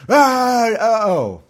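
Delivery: high, loud mock yell into uh-oh; comic alarmed "ah"; hammed-up startled cry. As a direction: cry out, then realise it's awkward. Army finds its leader dead.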